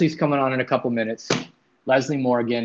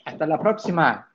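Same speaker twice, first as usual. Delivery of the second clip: Spanish phrase; 3 semitones higher than usual. Shown as a demonstration. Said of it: "Or we could say".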